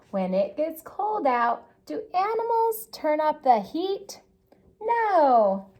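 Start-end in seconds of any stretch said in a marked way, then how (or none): 1.9-5.7 put-on reading to children. A narrator's reading.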